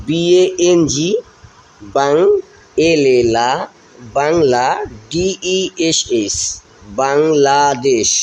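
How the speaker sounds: বাংলাদেশ slowed, spelled letter by letter, then বাং, লা, দেশ; broken-down pronunciation. Shown as a demonstration. Teacher spelling বাংলাদেশ for pupils, syllable by syllable. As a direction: build বাংলাদেশ syllable by syllable for the class. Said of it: "আমরা এইভাবে ভেঙে ভেঙে উচ্চারণ করব".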